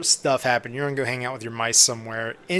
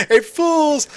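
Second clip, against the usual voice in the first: mock greeting; 13 semitones higher, 6 dB louder than usual.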